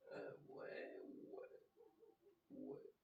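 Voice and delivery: breathy whispered yeahs under breath. Real, not acted. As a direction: mutter yeah to yourself in a whisper. Checking the card under breath.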